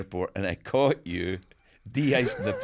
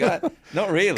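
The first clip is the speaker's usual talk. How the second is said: reluctant refusal; 8 semitones higher, 5 dB louder than usual. Retold or imitated. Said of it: "I'm like".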